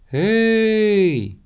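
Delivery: high, drawn-out hail; calling from below. A narrator's reading. Friend on the ground hailing someone, high long call. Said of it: "услышал он знакомый голос с земли".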